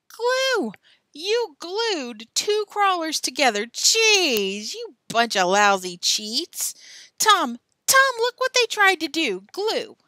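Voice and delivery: high, loud accusation; outraged yelling. Acted. Billy shows Tom the glued worms, outraged yelling. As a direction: yell it out as if catching cheats.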